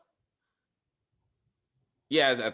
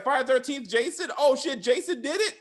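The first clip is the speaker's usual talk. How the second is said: higher, laughing through the question; laughing mock surprise; 10 semitones higher, 5 dB louder than usual. Jay realizing Jason did it, laughing and higher. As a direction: giggle the realization out. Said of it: "Oh, Jay's like".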